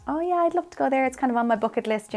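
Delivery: retold eager reply, pitched high then easing down; high, keen "oh yeah" to friends.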